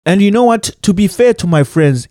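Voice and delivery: usual speaking voice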